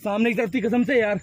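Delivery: loud, frightened calling out; alarmed shout. Real, not acted. Friend in the dark jungle warning the creature came near, frightened shout. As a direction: yell to warn your friends it's close.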